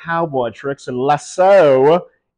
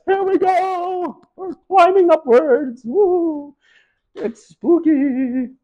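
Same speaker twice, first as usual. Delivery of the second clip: much higher, slow, squealing climb voice; squeaky excited voice; slower than usual. Pretend. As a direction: squeak it out like a nervous cartoon.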